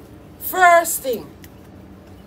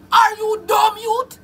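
ordinary talk

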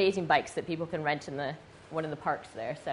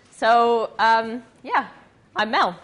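normal talking voice

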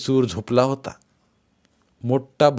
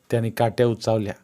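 plain reading voice

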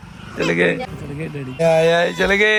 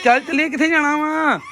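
lifted much higher, playful sing-song; 9 semitones higher than usual. Real, not acted. Father coaxing toddler to leave, high sing-song. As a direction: coax the little one along in a high sing-song.

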